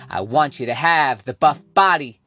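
ordinary talk, nothing marked

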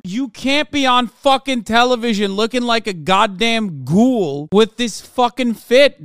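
ordinary delivery

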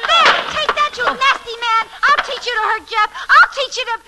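high, loud, furious while swinging; fierce scolding. Acted. Nancy hits the intruder while defending Jeff, fierce scolding. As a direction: whack him and scold him.

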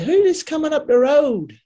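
high, loud call from the boss. Retold or imitated. Boss spots a buyer coming up the road, calls out. Boss voiced in retold story.

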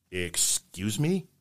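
hushed and drawn out in shock; shocked question. Acted. Joke patient answering doctor, slow soft disbelief. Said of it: "shocked patient in joke".

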